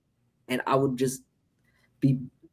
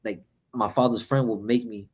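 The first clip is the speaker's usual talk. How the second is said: usual speaking voice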